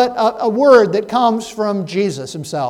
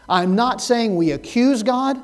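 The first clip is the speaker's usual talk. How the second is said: usual speaking voice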